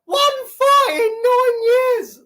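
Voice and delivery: high, slow put-on whimper; mock wail. Mock crying over his record.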